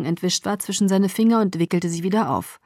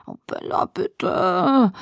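whining; much slower than usual. A narrator's reading. Friend begging for details in class, hushed whining. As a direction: wheedle and whine in a hushed voice. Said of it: "quengelte sie".

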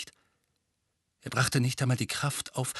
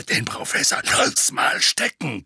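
gruff, dark growl; 4 dB louder than usual. Acted. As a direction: growl it like a battle-scarred old soldier. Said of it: "knurrte die Stimme".

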